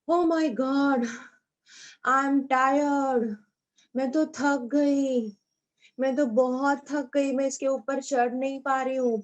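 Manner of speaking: slow, drawn-out worn-out sighing; tired voice. Shown as a demonstration. Teacher acting out Priya tired after the climb, drawn out and weary. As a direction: drag the words like you just climbed a mountain. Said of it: "ऐसी आवाज निकालते ना हम जब हम थक जाते हैं".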